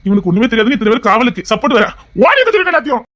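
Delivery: high, loud exasperated yelling; angry shouting. Acted. Father scolding the runaway boy, high loud angry shouting. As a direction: rage at him as he runs.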